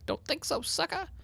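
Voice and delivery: mock the monsters in a silly high voice; taunting high cartoon voice. Shown as a demonstration. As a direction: Minecraft player taunting zombies in a high silly voice. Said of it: "I'm gonna say it like that".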